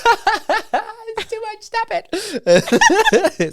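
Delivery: high, slow, squeaky voice through laughter; squeaky drums voice. Retold or imitated. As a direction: voice the drums begging to stop. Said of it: "I can imagine the drums being like".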